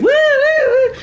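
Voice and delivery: very high-pitched drawn-out "woo"; imitated kid's wooing. Friend retells blooper of kid running past camera, high whooping. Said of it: "this kid runs past going".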